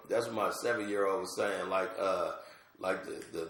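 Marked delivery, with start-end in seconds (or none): none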